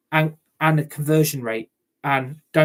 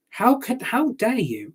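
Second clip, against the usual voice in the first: pitched high, incredulous fury; how dare you, outraged fan; 7 semitones higher, slower than usual. Real, not acted. Fan outraged at owner's comments.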